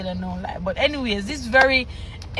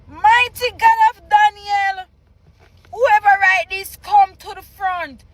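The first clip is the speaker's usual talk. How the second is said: higher, louder hammed-up pastor crying out; mock preacher call; 5 semitones higher, 4 dB louder than usual. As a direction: summon the writer like a fiery pastor. Mock pastor summons again.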